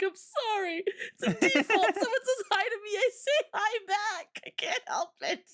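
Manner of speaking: high, apologetic whine; sheepish excuse. Retold or imitated. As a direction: plead it's a reflex.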